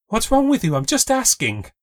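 high, loud and hurt; upset outburst. A narrator's reading. Kayla snapping back at her friend, upset and loud. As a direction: fire it back, stung and defensive.

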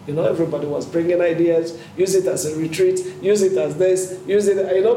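ordinary talk, nothing marked

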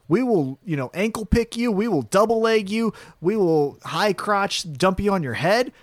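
ordinary delivery

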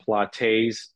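"Pilates" ending in "tayz"; "Pilates" with a wrong long A at the end; mispronounced Pilates. Shown as a demonstration. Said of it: "people pronounce it wrong a lot".

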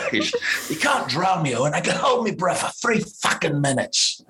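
high, laughing put-on bravado shout; imitating Statham taunting him; boastful Statham. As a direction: puff up and dare him, half laughing. Statham boasting, voiced in story.

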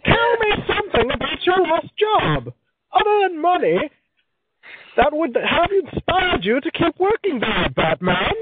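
high, slow theatrical villain voice; drawn-out hammy supervillain read; Bane voice. Shown as a demonstration. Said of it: "It was, actually".

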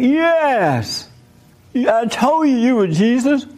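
drawn-out, high-pitched cheer; mock jubilation. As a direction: imitate thrilled disciples celebrating. Disciples cheer the calmed water.

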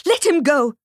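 mother demanding her son be freed; firm command. A narrator's reading. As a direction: order her to release him. Mother stops the slap.

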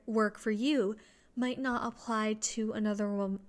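ordinary delivery

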